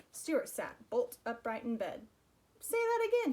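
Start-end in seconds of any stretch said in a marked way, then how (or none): 2.6-3.3 high, loud eager plea; excited. Acted. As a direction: leap up with excitement. Stuart thrilled by the song.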